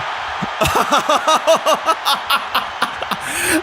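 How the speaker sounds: long, high laughing fit; delighted laugh. Real, not acted. Player watching his wrestler's entrance, delighted high laughter. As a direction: burst out laughing.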